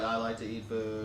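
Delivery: slow robot monotone; robot voice, flat and mechanical. Retold or imitated. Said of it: "It sounded like a robot".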